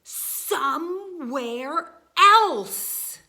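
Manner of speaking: loud drawn-out insistence; emphatic demand. Acted. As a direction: insist on it loudly. Elephant demands birds go.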